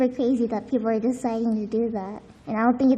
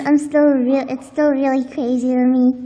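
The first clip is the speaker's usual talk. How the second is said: ordinary talk, nothing marked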